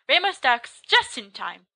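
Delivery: usual speaking voice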